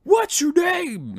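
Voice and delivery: slow, high, loud drill-sergeant bark; stern demand. Retold or imitated. Sergeant major questioning a knucklehead, slow loud bark. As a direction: interrogate a soldier you caught. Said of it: "he goes".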